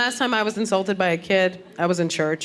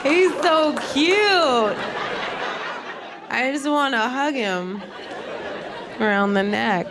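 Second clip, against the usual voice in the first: slow, strained fake-sweet gushing through laughter; gritted-teeth adoring voice; forced sweetness; 2 semitones higher, slower than usual. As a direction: pretend to adore the kid who insulted you. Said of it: "you just gotta stand there like".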